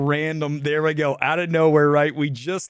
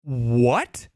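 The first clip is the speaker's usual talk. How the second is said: slow, stunned disbelief, higher pitch; what did you just say, aghast; incredulous; 6 semitones higher, slower than usual. Retold or imitated. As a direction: drag it out in disbelief. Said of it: "You're like".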